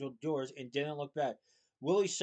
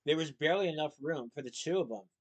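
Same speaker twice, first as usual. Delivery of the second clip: plain reading voice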